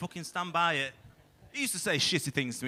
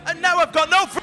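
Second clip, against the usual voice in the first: much higher, louder punchline yell; shouted cut-off; 13 semitones higher, 10 dB louder than usual. Real, not acted. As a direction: bellow and drop the mic.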